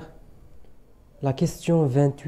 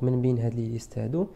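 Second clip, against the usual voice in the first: normal talking voice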